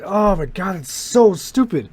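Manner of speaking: ordinary delivery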